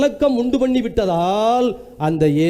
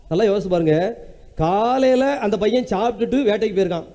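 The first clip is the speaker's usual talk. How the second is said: usual speaking voice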